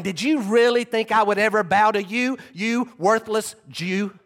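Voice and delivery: a soldier jeering as he beats the prisoner; mocking yell. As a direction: spit the words at him with contempt. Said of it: "all the time yelling".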